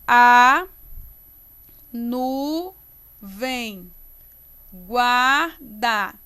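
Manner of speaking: isolated syllables with long pauses; deliberate syllable splitting. Shown as a demonstration. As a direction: scan slowly, NU-VEM GUAR-DA O pulled apart. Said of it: "Vai junto, ó".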